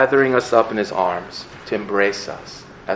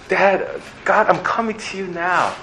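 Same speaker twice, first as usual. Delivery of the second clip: put-on childlike prayer; 7 semitones higher, 3 dB quieter than usual. Child coming to God in prayer.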